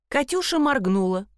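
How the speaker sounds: plain reading voice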